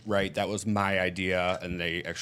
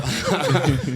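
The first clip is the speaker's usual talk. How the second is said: short laugh; 6 semitones higher, 7 dB louder than usual. Real, not acted. Laughs at own story.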